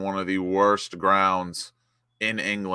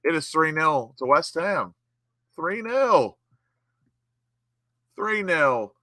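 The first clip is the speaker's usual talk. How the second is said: higher, savouring the score; elated score call; 6 semitones higher, slower than usual. Real, not acted. Fan updating the score to three nil, elated. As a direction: announce it like a trophy.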